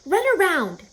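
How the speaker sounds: high, loud order; commanding. Acted. Charlotte ordering Wilbur to run, commanding. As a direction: snap the command at him. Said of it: "commanded Charlotte".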